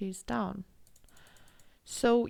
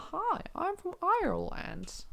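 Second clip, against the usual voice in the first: much higher mock Irish accent greeting; 10 semitones higher than usual. Shown as a demonstration. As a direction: play a cheery Irish local, higher. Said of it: "I'm not good in this".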